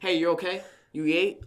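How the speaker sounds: loud, high mock concern; annoying check-in. Family checking up on him, imitated annoying concern. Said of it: "it's kind of annoying now".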